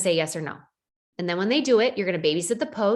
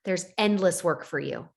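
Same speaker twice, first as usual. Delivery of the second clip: ordinary talk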